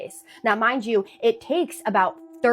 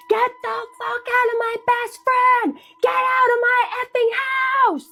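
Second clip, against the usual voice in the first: screamed high and loud, a retold exorcism shout; furious scream; 13 semitones higher, 4 dB louder than usual. Molly screaming at the possessed best friend, full furious shout. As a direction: yell at the thing inside her friend to get out. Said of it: "starts screaming".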